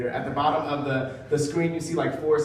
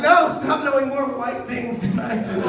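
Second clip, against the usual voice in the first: exasperated protest; 9 semitones higher, 4 dB louder than usual. Acted. Acted exasperation at being one-upped.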